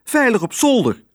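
high-pitched, insistent mouse aunt; shrill cry. A narrator's reading. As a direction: call out shrilly and firmly. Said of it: "riep ze schril". (schrille, besliste uitroep)

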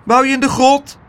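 very high, loud little doorman; pint-sized gatekeeper challenging a visitor. A narrator's reading. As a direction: ask sharply in a tiny high voice. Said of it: "Toen zei het stemmetje".

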